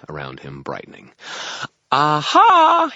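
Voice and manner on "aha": very high, loud, sung exclamation; singsong fairy. A narrator's reading. Fairy remembers a guide, triumphant singsong. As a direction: sing it out in triumph. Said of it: "he sang".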